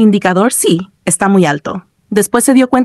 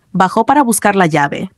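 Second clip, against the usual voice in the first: ordinary delivery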